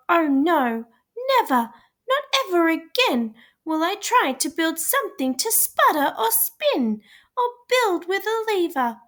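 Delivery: put-on a little girl swearing off inventing; upset girl's vow. A narrator's reading. Rosie vows never to build again after the crash, upset and high.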